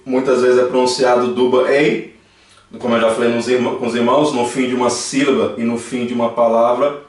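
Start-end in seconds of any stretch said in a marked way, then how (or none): none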